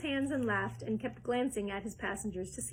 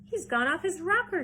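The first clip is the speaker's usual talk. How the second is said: high, loud appalled father; louder, higher shocked cry; aghast shout; 8 semitones higher, 8 dB louder than usual. Acted. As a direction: shout it in alarm. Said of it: "shouted one of the fathers, aghast".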